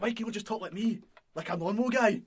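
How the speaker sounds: hammed-up, incredulous protest; Drew Galloway. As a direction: plead loudly in an impression of an indignant wrestler. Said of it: "when he's talking about Scottish actors".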